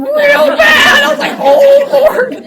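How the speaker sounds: high, loud, laugh-crying; laughing hard. Real, not acted. Mom laughing to the group about the bathroom emergency. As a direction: crack up at the memory.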